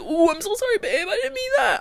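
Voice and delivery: high, cooing imitation of saying sorry; mock apology. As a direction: mimic an over-sweet sorry.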